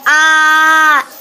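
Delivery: loud, drawn-out scream of fright; startled yell. A narrator's reading. Father gets scared from behind by his son, startled yell. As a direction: yell out long and loud in shock. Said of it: "His father yelled".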